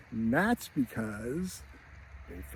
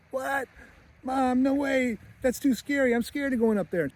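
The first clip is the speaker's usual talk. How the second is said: high-pitched mock frightened little boy; scared kid protesting; 11 semitones higher than usual. Frightened boy protests to his mother.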